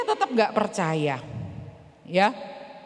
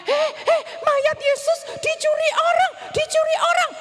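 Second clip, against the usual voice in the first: high alarmed shouting to friends; urgent call; 12 semitones higher than usual. Acted. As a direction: alert the others in a panic. Calling disciples in alarm.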